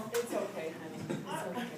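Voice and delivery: soft, gentle reassurance; soothing. Retold or imitated. Owner reassures scared dog, soft and soothing. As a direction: comfort softly, as to a small child.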